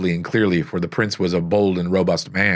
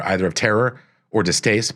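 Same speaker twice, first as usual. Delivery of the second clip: plain reading voice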